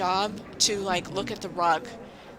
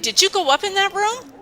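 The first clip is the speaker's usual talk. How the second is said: much higher, louder questioning voice; mock interrogating boss; 12 semitones higher, 6 dB louder than usual. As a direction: play the suspicious boss, pitched way up.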